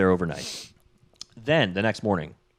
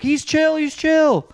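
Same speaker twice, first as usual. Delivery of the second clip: put-on high, loud kid's plea; urgent pleading; 5 dB louder, slower than usual. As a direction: plead it high to calm someone down. Hogarth urgently calming Dean.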